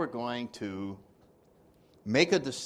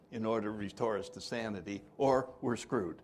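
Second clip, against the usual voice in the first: ordinary talk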